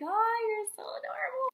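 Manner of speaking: high, loud fangirl squeal; gushing. Retold or imitated. Fan gushing over idol's huge smile, adoring.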